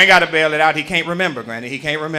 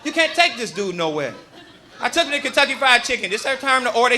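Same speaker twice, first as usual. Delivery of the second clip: usual speaking voice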